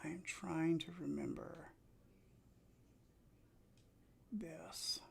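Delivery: very soft, whispered aside; talking to oneself. Real, not acted. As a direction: whisper it absently while concentrating. Talks to self while playing.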